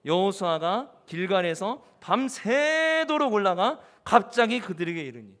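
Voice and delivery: loud, slow verse reading with drawn-out emphasis; congregational scripture reading. Acted. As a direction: lead everyone firmly through the verse, phrase by phrase. (크고 느리게 힘주어 읽는 성경 봉독)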